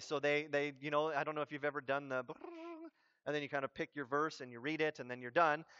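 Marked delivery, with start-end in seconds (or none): 2.2-2.9 lip-trilled riffle of pages; page-flipping noise. Retold or imitated. Preacher mimics flicking to a random verse, playful trill. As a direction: imitate pages flipping with a brrr.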